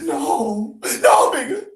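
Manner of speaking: high, rising shout; cry out. Acted. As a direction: plead loudly as he threatens to leave. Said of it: "She cried".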